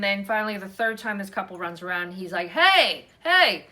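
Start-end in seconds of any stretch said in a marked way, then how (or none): 2.5-3.7 loud, high hey to get attention; mock call after the joggers. Chip calling out to the running couple, loud and high.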